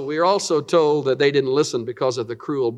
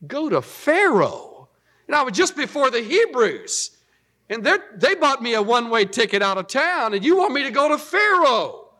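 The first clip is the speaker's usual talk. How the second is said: higher, disbelieving protest; incredulous Moses; 8 semitones higher than usual. Retold or imitated. Moses balking at going to Pharaoh, higher and incredulous. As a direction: throw the question back in disbelief.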